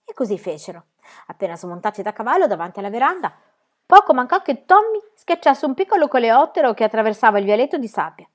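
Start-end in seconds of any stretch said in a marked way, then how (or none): none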